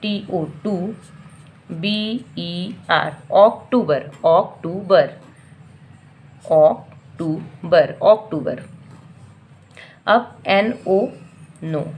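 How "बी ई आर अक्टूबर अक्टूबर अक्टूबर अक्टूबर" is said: slow बी ई आर then अक्टूबर repeated firmly; teacher drill; syllable drill. Shown as a demonstration.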